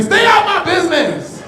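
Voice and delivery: high, loud shout from strangers behind; hammed-up crowd shouting; mock yell from the line. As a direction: yell at him to butt out. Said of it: "People behind me".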